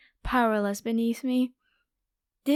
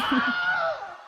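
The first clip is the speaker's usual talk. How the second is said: sudden high villain cackle; high gleeful cackle. Acted. Vampire spotting a young man in the forest, cackling. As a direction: cackle with delight at the prey. Vampire gloats over found prey.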